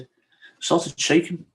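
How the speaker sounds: real emotion welling up while retelling; halting, then pushing on through the feeling; emotional. Real, not acted. Man apologising for emotion while recalling his panic attack, choked with emotion. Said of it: "I always get emotional telling this bit".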